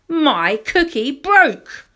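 put-on slow, loud childish complaint; high, drawn-out toddler grievance; upset wail. A narrator's reading. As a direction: wail it as a small disaster. Child narrator's tantrum over broken cookie.

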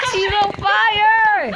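high laughing yell, stretched out; laughing shout. Real, not acted. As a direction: shriek it through laughter. Laughing shout at burning friend.

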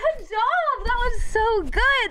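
high, slow praise like cooing at a puppy; mock pet praise. Praising the viewer who barked like a dog, high and slow.

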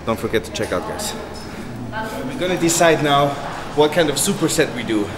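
ordinary talk, nothing marked

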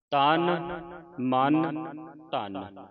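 ਤਨ, ਮਨ, ਧਨ one by one, separated by pauses; un-nasalised vowels. Shown as a demonstration. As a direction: say ਤਨ ਮਨ ਧਨ word by word, no added nasal. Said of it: "ਟਿੱਪੀ ਲਾ ਕੇ ਉਚਾਰਨ ਨਹੀਂ ਕਰਨੀ".

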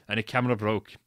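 ordinary delivery, nothing marked

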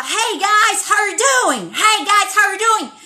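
high, loud bubbly teacher greeting; upbeat American teacher. Retold or imitated. Teacher greeting her eleven-year-old student, high and loud. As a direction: chirp it like an excited teacher back from the States. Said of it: "ela falava".

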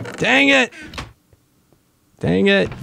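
squeaky high dang it; sharp, high-pitched annoyed cry; frustrated outburst. Real, not acted. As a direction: yelp it out in frustration.

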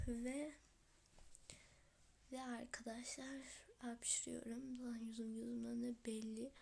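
hushed, mostly whispered, with a sore throat; sick whispery voice. Real, not acted. A sick kid greets her YouTube viewers in a whisper. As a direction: talk through a sore throat, barely voicing. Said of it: "sesimden de anlaşıldığı gibi hastayım".

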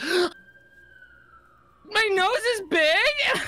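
imitating a girl upset about her nose; mock hurt gasp. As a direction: play the hurt girl, half crying. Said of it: "she was just like".

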